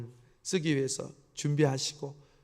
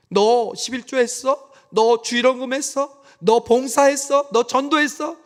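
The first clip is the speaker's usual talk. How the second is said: demanding questions; 8 semitones higher, 8 dB louder, slower than usual. Pretend. Imagined demanding checklist questions.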